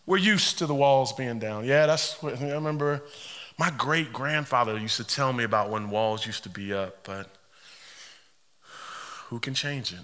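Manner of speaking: low, subdued and defeated; resigned. Acted. Preacher voicing people used to fallen walls, resigned. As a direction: let it sink down, given up.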